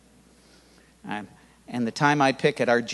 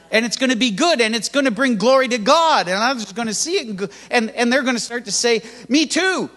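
higher, rising preacher's build; preaching at full force; 7 semitones higher than usual. Acted. Pastor urging the congregation they can do it, fervent preaching. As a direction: drive it home to the congregation.

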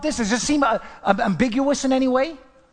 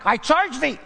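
"I charge thee" pitched up, a firm command; forceful, raised "I charge thee"; emphatic charge; 4 semitones higher than usual. Retold or imitated. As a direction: punch out "I charge thee" like an order. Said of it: "it's emphatic".

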